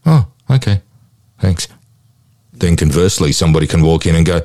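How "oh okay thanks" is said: flat, unimpressed, higher voice; indifferent. Retold or imitated. Depressed person reacting to a jackpot win, flat and unmoved. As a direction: shrug it off, utterly unmoved.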